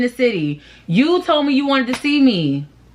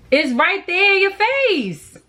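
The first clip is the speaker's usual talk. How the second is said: higher, slower, incredulous; exasperated, pitched up; 8 semitones higher, slower than usual. Acted. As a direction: stress how obvious it is. Exasperated emphatic point.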